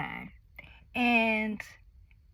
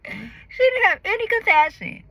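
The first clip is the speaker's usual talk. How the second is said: indignant; 12 semitones higher, 7 dB louder than usual. Acted.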